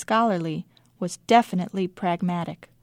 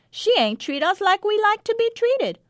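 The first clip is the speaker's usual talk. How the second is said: put-on child's voice; 13 semitones higher, 3 dB louder than usual. A narrator's reading. Child explains the stabbing.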